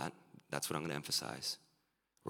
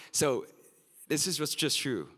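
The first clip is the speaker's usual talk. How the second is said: emphatic laughing; 10 semitones higher, 9 dB louder than usual. Real, not acted. Laughing emphatic after joke.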